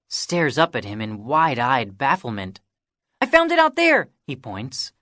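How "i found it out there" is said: put-on high, loud little-boy voice; excited boy showing off a find; eager kid. A narrator's reading. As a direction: pipe up like an eager younger kid. Kiki voiced by narrator.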